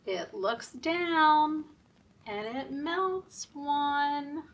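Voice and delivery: rhythmic nursery rhyme chant, slow on the sun; sing-song rhyme. Acted. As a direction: chant the rhyme for little kids. Reading rhyme at story time.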